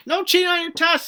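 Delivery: high, loud, fast puppet voice; squeaky scolding mascot; Broby voice. Pretend. Broby the mascot warns the class, high and loud. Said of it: "says Broby".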